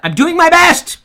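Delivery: high, loud plea of exasperation; mock outburst. Host answering microphone complaints, mock-hysterical. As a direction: yell it like a hammed-up meltdown.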